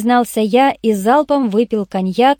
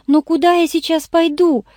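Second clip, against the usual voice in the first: high-pitched plaintive whine; whimpering; 7 semitones higher than usual. A narrator's reading. Irene is being thrown out of the office, whimpering. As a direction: whine helplessly in a raised voice. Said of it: "захныкала Ирен".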